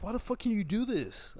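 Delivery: whiny outrage at the world; mock outraged whine. Frustrated teen rants at everybody in a high whine.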